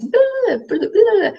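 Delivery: very high gibberish babble; mock foreign babble. Vlogger imitating café chat with her friend, high mock French babble. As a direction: trill out nonsense as pretend French. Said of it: "we were talking like this".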